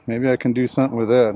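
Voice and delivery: ordinary talk, nothing marked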